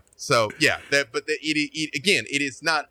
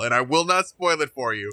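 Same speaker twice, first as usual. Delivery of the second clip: ordinary talk, nothing marked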